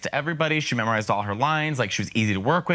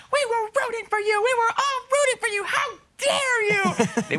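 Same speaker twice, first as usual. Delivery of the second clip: much higher, dramatic scolding; Tyra's famous meltdown, far higher; Tyra Banks. Retold or imitated. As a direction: belt it like a diva scolding.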